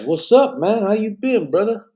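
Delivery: high, loud, happy hello; excited greeting. Real, not acted. Greeting a returning viewer warmly.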